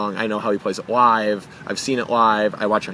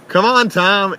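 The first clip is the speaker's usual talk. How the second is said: calling out; 9 semitones higher, 6 dB louder, slower than usual. Retold or imitated. Bruce calling him in.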